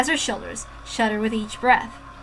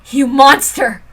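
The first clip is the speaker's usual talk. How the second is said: sharp angry cry at the villain; angry outcry; 2 semitones higher, 8 dB louder, slower than usual. Acted. Girl cursing her captor, angry outcry. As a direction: spit it at him in outrage.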